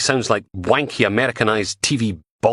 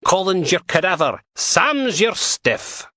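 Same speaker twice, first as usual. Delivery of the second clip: slow, pitched-up pun delivery; drawn-out punchline; 12 semitones higher, slower than usual. A narrator's reading. Handler savouring her corpse puns to Logan, slow and high.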